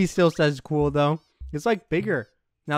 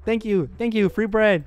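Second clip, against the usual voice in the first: ordinary delivery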